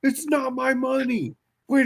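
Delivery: very high, loud frantic shriek; hammed-up frantic friend; hammed-up panicking John. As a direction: shriek in mock horror at the cash. Acting out John's panic.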